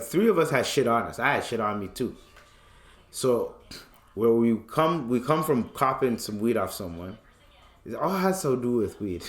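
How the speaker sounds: ordinary talk, nothing marked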